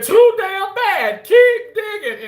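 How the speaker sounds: slow, loud, high shout; meme grandpa reply. Retold or imitated. Streamer answers coworker with meme line, hammed-up shout. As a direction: holler it back, drawing out each word. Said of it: "And I said".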